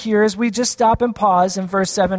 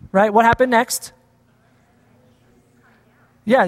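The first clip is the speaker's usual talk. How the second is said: normal talking voice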